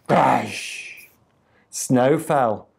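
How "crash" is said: drawn-out, breathy crash; storyteller's thunder sound effect. A narrator's reading. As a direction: let the crash trail into a long "shhh".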